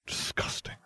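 unvoiced disgusted whisper. A narrator's reading. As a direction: breathe it with disgust. Disgusted aside in narration.

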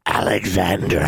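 a bird's cawing call of a name; loud call. Acted. Crow calling the girl's name loudly. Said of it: "Hagar loudly called out".

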